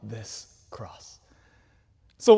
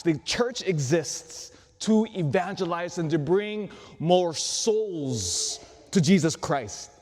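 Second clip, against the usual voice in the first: ordinary talk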